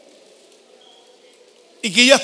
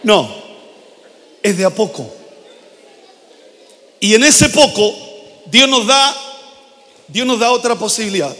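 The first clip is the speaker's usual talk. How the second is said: ordinary delivery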